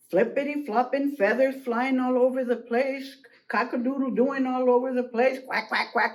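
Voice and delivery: plain reading voice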